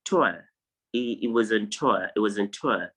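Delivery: tour as toə, no r at the end. Shown as a demonstration. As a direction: say tour, ending open on uh. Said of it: "it's pronounced".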